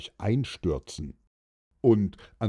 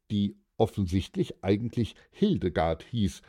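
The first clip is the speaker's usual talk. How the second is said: ordinary delivery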